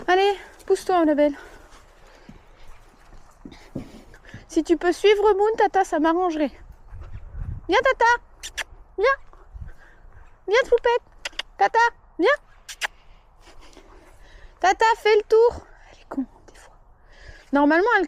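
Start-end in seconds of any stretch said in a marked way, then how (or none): 0.1-15.7 high coaxing call. Real, not acted. Calling the mare over. 15.9-16.7 whispered aside, unvoiced. Real, not acted. Aside under breath.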